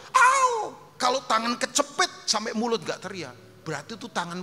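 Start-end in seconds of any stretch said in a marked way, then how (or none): 0.1-0.7 a very high, loud "Au!" as if your hand were caught in a door; demonstrated pained cry; demo yell of pain. Shown as a demonstration. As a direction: yelp "Au!" high and sharp. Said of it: "teriak nggak mulut?".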